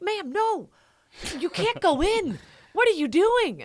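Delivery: high, loud, alarmed clerk voice; mock clerk. Retelling the clerk blocking her way in, high and alarmed. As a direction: bar the door like a shocked clerk.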